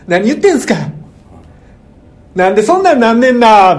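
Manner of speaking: high, loud mock tsukkomi; 関西のツッコミ. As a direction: blast out a hammed-up Kansai comeback, stretching the end. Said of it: "ツッコミやねん". (声を張った大げさなツッコミの真似)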